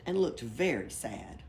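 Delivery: plain reading voice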